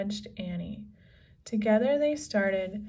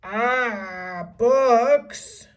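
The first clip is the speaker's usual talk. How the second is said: loud, disgusted pirate growl; 3 semitones higher, 10 dB louder than usual. A narrator's reading. Captain unimpressed by books, disgusted growl. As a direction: spit the word out. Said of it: "said Cap'n Bones".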